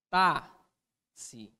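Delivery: TÁXI broken as "tá... xi", long gap; split into syllables. Shown as a demonstration. Teacher giving táxi as an example, syllable by syllable. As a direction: say "táxi" as two separate beats, first one strong.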